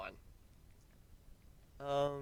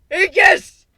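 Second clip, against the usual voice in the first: high, loud shouted attack name; battle cry; 17 dB louder than usual. Acted. Calling out a sword attack.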